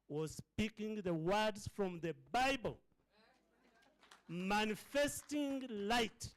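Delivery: rising, slow preacher's swell; preaching build. Real, not acted. Preacher praising proud parents, drawn out. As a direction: lift the room like a preacher.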